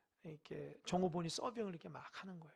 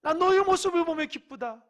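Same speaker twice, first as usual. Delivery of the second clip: higher, much louder, overjoyed proclamation; God rejoicing; 7 semitones higher, 14 dB louder than usual. Acted. God delighting in a beloved son and daughter, loud joy. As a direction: burst out with a father's overflowing delight. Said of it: "하나님 볼 때에".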